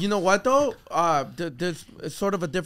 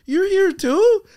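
high, bright-eyed fake surprise, louder; mock cheerful surprise; 12 semitones higher, 7 dB louder, much slower than usual. Teasing his ex at lunch, mock surprised. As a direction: pop up at her like it's a coincidence. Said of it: "walk up to her and be like".

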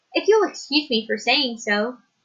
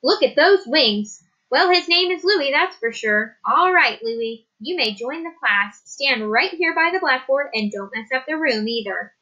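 teacher voice; 3 semitones higher than usual. Acted. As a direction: set the rules like a firm first-grade teacher. Teacher voiced in read story.

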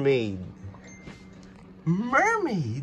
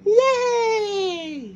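high, loud, long pretend cheer; excited cheer; 14 semitones higher, 6 dB louder than usual. Trolls asked if ready to swim, high excited cheer. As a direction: cheer it out for the toys. Toys cheer about swimming.

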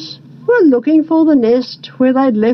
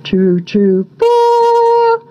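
bird calls held long on high notes; bookmaker birds; 9 semitones higher, much slower than usual. A narrator's reading. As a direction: hoot the bets, jumping up high. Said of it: "said the bookmakers".

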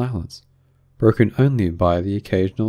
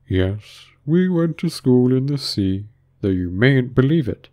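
slow, higher storytelling creature; doleful Mock Turtle; 5 semitones higher, slower than usual. A narrator's reading. Mock Turtle resuming his school story, slow and earnest. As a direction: draw out the tale in character.

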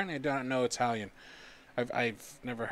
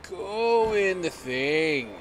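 slow, louder, higher plea; drawn-out urging; 7 semitones higher, 10 dB louder, much slower than usual. Real, not acted. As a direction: stretch every word out. Urging character in.

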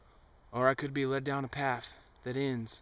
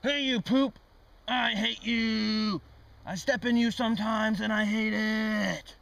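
higher, louder hammed-up insults; mock angry yell; 6 semitones higher, 4 dB louder than usual. Insulting the poop to test its reading, mock angry yell. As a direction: yell at the poop like a petty enemy. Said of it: "more of a negative kinda guy".

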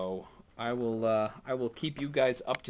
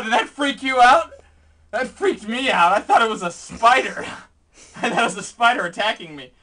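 higher and louder, still rattled; shaken excitement; 9 semitones higher, 12 dB louder than usual. Real, not acted. Vlogger recovering from a spider scare, rattled. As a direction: talk fast through leftover panic.

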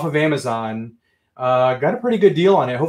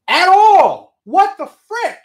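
high, loud, fed-up cry; exasperated outburst; 13 semitones higher, 6 dB louder, slower than usual. Real, not acted. Streamer ranting to chat about the dealer, exasperated outburst. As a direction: blow up in disbelief.

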